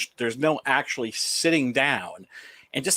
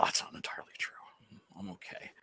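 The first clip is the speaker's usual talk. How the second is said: hushed confession aside; whispering; 6 semitones lower, 15 dB quieter, faster than usual. Real, not acted. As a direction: whisper it like a secret. Whispered aside about weed.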